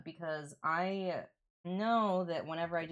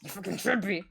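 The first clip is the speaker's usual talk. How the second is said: louder, punchy excited repeat; 3 semitones higher, 4 dB louder than usual. Real, not acted. Streamer urges new viewers to follow, excited outburst. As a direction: blurt it out with sudden excitement. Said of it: "sorry, I got excited".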